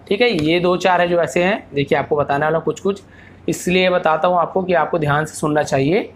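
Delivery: ordinary talk, nothing marked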